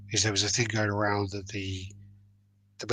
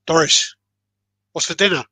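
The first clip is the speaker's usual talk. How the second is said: higher, louder call across the room; 7 semitones higher, 10 dB louder than usual. Retold or imitated. Husband calls loudly to his wife across the house. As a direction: shout it over twenty feet.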